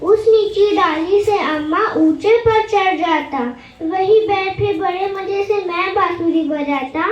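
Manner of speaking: singsong verse, line by line; poem recitation. Acted. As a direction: recite it in a measured rhyming lilt.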